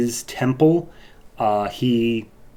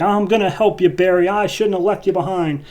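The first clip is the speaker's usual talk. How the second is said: earnest Manuel; 9 semitones higher than usual. Retold or imitated. Manuel's quote, voiced by the reviewer.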